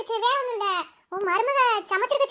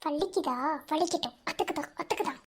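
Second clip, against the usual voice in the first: low muttered jibe; 8 semitones lower, 11 dB quieter than usual. Acted. Muttered jibe while feeding him.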